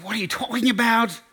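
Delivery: high-pitched mock indignation. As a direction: imitate a girlfriend losing patience. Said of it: "makes her even more angry".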